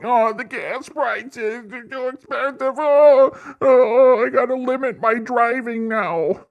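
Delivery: high, whining mock voice with laughs; high, whiny parody of a complaining liberal; mocking whiny complainer. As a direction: whine like a broke crybaby over gas prices. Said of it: "go like".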